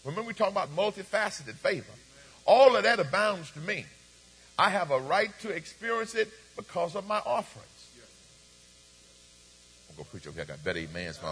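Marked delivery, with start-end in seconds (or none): 2.4-7.9 preaching at full force. Real, not acted. Preaching building to a peak. 9.9-11.2 quiet aside. Real, not acted. Aside, moving to other side.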